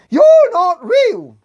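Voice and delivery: drawn out high and slow; slow, high-pitched dismissal; emphatic disbelief. Acted. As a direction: stretch each word in mock disbelief. Upset politician confronts the holiday figures.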